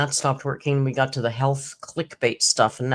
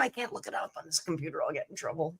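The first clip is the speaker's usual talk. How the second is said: hushed; 12 dB quieter, faster than usual. Retold or imitated. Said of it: "He was like".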